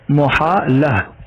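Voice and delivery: मुहाला drawn out slowly with mu as the wrong form; wrong pronunciation. Shown as a demonstration. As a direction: stretch out मुहाला with the wrong u sound. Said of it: "ये गलत तलफ़ुज़ है". (ग़लत उच्चारण मुहाला, धीरे)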